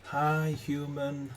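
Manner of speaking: high, small creature voice; gecko voice. Acted. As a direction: chirp it like a tiny gecko. Gecko greets Ruby.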